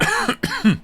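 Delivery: loud high choking cough. Real, not acted. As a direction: cough hard, choking on a drink. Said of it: "I'm choking".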